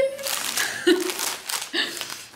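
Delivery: high breathy giggle of delight; excited giggle. Real, not acted. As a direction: let out a giddy little laugh. Giggles after saying she's overexcited.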